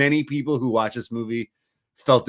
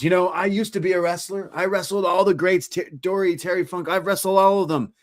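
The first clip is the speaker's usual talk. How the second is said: higher-pitched retold brag; boasting wrestler; 6 semitones higher than usual. Ex-wrestler boasting to Barry about his career, imitated brag. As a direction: imitate a wrestler listing his old glories.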